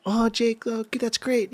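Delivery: lightly put-on voice, high and soft; imitating Rodney. Teammate praising the filmer, imitated high and soft. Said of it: "Rodney talked to me like".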